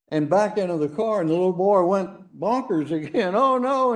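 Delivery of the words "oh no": high, sudden mock alarm of a child; panicked cry. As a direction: squeal it like a scared little boy. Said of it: "and he's panicked".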